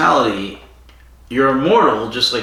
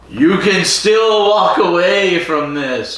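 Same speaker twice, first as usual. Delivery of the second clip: solemn Rassilon warning; 8 semitones higher, 4 dB louder than usual. Retold or imitated. Rassilon warns Barusa.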